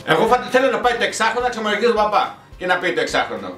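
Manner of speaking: normal talking voice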